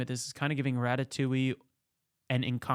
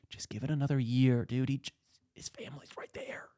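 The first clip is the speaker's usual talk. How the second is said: imitating a whispered, urgent plea; hushed pleading. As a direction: whisper it to a stubborn colleague. Inspectors plead near the grieving family.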